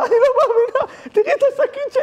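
very high, squeaky acted wail; hammed-up tearful squeal; mock crying. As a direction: imitate someone weeping in a tiny high voice. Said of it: "היא מתחילה לבכות".

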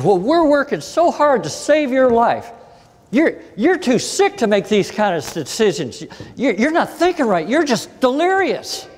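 high, shrill-free mock scolding of a patient; mad doctor scolding. Doctor scolding her patient, mock angry and high. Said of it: "she got so mad at me".

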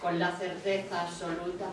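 measured, even poem recital; poetry reading. Acted. Reader sharing the orange chakra sea poem, measured recital. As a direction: read in unhurried phrases, each settling at its end.

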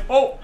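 high "oh" breaking into laughter; exercise laugh. Shown as a demonstration. Leader stopping at red light to laugh, hearty laugh. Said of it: "we stop and we laugh".

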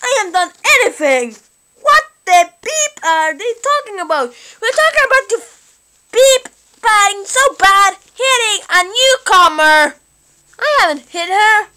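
high, loud, heated back-and-forth; worked-up squabble; indignant quarrel. Acted. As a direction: protest hotly over an accusation. Acted quarrel over hitting newcomer.

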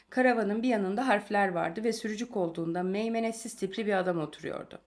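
ordinary delivery, nothing marked

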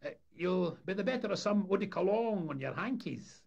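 cheeky lad calling out to visitors; high, loud brash Scots greeting; Sonny Jim voice. Acted. Deckhand teasing the deputation.

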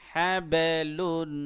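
হ্যাবেলুন drawn out very slowly and loud, the ব bounced; হ্যাবেলুন recited slowly and loudly with a jolt on ব; qalqala demo. Shown as a demonstration. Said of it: "এরকম".

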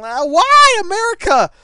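high, very loud wail of protest; exasperated cry. Real, not acted. Player protesting to America, exasperated cry. Crying out at game.

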